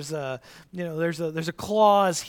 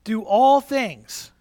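slow and louder, leaning on all; preacher drilling the verse, slow and louder; slow emphatic recital; 4 semitones higher, 9 dB louder than usual. Shown as a demonstration. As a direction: drill it home word by word. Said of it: "Let's say it together".

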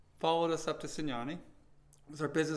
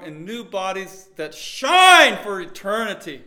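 loud, raised pitch, stretching key words to a shout; full-force preaching; 4 semitones higher, 14 dB louder than usual. Acted. Preacher promising the congregation new bodies in eternity, full-force preaching. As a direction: proclaim it with rising fervour, ringing out loud.

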